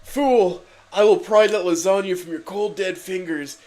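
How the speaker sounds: hammed-up battle shout; booming voice. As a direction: boom defiance at the villain. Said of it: "exclaimed Garfield with a booming voice".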